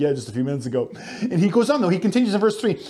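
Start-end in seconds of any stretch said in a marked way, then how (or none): 0.0-0.9 quick amused aside through a laugh; laughing mock reply. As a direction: tease the crowd with a chuckle. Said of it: "Some of you are probably like".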